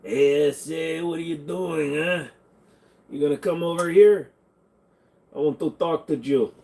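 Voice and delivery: put-on Mexican accent; hammed-up Mexican accent, calling someone over. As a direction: play up a Mexican street accent. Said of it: "do a good Mexican accent".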